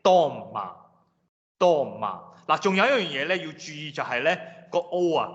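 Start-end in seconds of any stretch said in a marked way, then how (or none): 0.0-2.2 doma as long DOM, short light ma, slowly; stressed O. Shown as a demonstration. Teacher modelling Russian stress, doma with long first syllable. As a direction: hold the do of doma and drop ma. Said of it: "如果係上面有一撇咧係讀O嘅".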